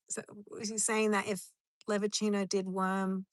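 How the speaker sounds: ordinary talk, nothing marked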